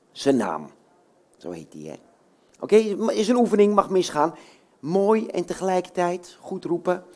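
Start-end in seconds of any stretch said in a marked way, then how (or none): none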